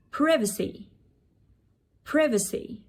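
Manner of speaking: "privacy" with a short i, PRIV-uh-see; first vowel of "privacy" as in "give"; British pronunciation. Shown as a demonstration. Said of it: "British English that might be possibly pronouncing wrong".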